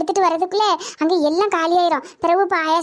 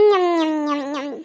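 low, whimpering munch; comic munching with a crying edge; chewing noises; 6 semitones lower, 3 dB quieter, slower than usual. Acted. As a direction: chomp with a small whine. Chewing while being fed.

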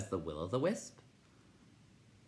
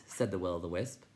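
plain reading voice

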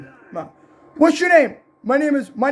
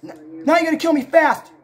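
ordinary talk, nothing marked

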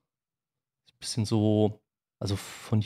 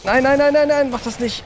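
panicked pleading; 13 semitones higher, 4 dB louder than usual. Real, not acted. Panicked plea as enemy attacks.

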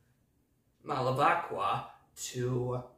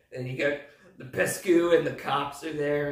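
usual speaking voice

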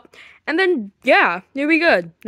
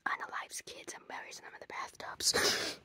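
breathy scared whisper; whispering a creepy rumour to Daddy; spooky whisper; 21 dB quieter than usual. Acted. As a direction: hush it like a ghost story. Spooky rumour about the killer.

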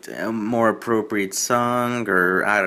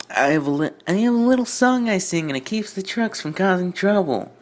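much higher put-on voice, insistent; Twilight the engine, proud; 9 semitones higher than usual. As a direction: play the small engine standing her ground, pitched way up. Twilight explains her song.

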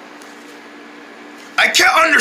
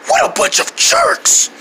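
very high, sulky squeak; offended, strained squeak; wheezy voice. Acted. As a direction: grumble through a blown-out voice. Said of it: "laughing at the way I sound".

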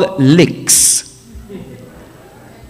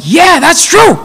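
higher-pitched keen student; eager agreement; 6 semitones higher, slower than usual. Acted. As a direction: act out naive, zealous agreement.

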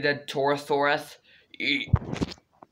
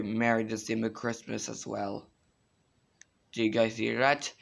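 ordinary talk

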